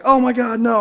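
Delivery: high, loud panicked cry; high, loud horrified retelling. Retold or imitated. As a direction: yelp it in sudden horror. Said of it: "I'm like".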